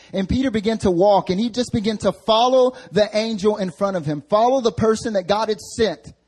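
high, loud preaching; impassioned preaching. Real, not acted. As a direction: drive the story home from the pulpit. Preaching at full force.

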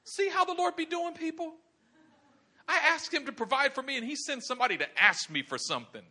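high-pitched grumbling; put-upon widow's gripe; indignant complaint. Acted. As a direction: complain in comic exasperation, pitch coming down. Widow grumbling about God.

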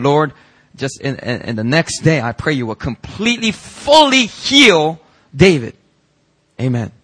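rising into a slow, loud plea on the last words; fervent retold prayer. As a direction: pray it out, swelling on the healing. Pastor quoting his own prayer.

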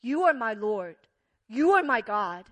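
ringing confession of faith; bold declaration. Acted. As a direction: declare it with full conviction. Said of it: "Thomas declares with his own mouth".

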